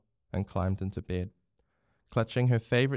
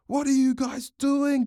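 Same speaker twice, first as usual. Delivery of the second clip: high, loud, slow exasperated yell; angry shout; 5 dB louder, slower than usual. A narrator's reading. Bronx berates his team after a goal, shouting. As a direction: holler it at your own team. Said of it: "Bronx shouted".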